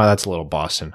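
ordinary talk

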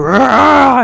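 imitating a hyped supporter yelling; mock crowd roar. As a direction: bark out a rowdy cheer.

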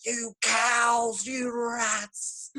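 high, drawn-out comic war cry; battle-charging nan. Retold or imitated. Nan charging at the Desperate Housewives, mock battle cry. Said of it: "come flying into battle with the Desperate Housewives".

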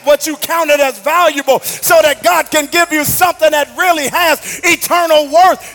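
preaching at full force. Acted. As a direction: drive the sermon home to the crowd. Preacher climbing to shout.

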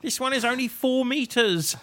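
high, slow mock complaint; Moss. Plug hero with too short a lead, high slow impression. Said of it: "sound like Moss".

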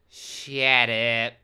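loud, drawn-out mock British retort; Jonathan Adams, hammed up. Actor cuts off his agent, hammed-up reading. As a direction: stretch it into a comic, accented rebuke. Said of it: "[name], you can be Jonathan Adams".

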